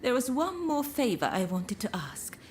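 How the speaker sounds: usual speaking voice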